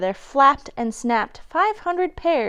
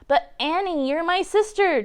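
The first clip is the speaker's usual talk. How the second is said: whining; 6 semitones higher than usual. A narrator's reading. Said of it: "Joe blubbered and he whined".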